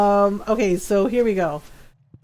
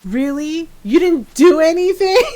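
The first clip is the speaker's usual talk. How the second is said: incredulous mock outrage, rising high and loud; retold mad sister; 5 semitones higher, 5 dB louder than usual. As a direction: throw the excuse back like an angry sister. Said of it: "his sister got mad and goes".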